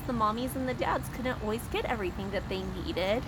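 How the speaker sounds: plain reading voice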